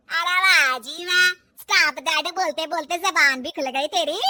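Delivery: furious mother-in-law's taunt; sarcastic outrage. Acted. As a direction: sneer it at shouting volume. Mother-in-law sarcastic and angry.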